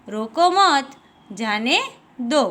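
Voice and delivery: रोको मत, pause, then जाने and दो set apart; pause after मत. Shown as a demonstration. Teacher repeating the comma example, pauses after मत and जाने. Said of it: "अल्प विराम का प्रयोग वाक्य में थोड़ा रुकने".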